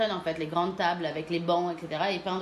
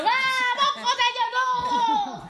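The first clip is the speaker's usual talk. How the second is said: put-on high, loud, slow wailing child tantrum; whiny tantrum; 6 dB louder, much slower than usual. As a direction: howl it like a kid denied his dessert. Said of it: "en disant".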